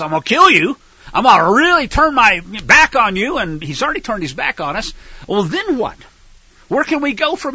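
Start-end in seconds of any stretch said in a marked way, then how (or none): 0.0-3.4 a loud, stern warning from God; divine warning. Acted. Preacher voices God warning his people, loud and stern. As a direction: thunder the threat at the rebellious people. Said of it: "he warned us".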